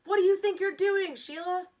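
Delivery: high, loud angry yell; boy yelling at classmate over the cover. A narrator's reading. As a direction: yell at her, caught red-handed. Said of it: "he yelled".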